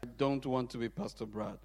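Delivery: normal talking voice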